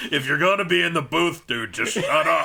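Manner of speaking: high shout, slowing on the last words; mock angry heckle. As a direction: yell it across the room in mock outrage. Acted heckle at the loud guy.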